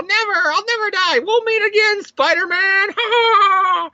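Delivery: very high Green Goblin cackling voice; hammed-up hysterical villain. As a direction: shriek it high like a comic-book villain, ending in mad laughter. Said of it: "starts laughing hysterically when it's all".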